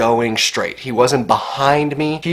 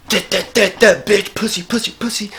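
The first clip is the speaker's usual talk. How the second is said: mocking taunt; 8 semitones higher than usual.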